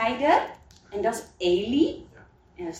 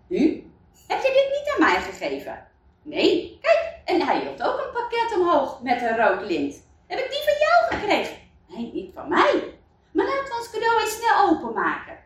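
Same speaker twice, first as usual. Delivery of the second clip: much higher, quick and eager; excited young villagers; 8 semitones higher than usual. Acted. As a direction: bounce between puzzled and eager. Cas and Stan puzzle over gifts. (veel hoger, snel en opgewonden)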